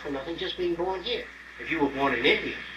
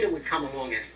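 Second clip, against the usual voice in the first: normal talking voice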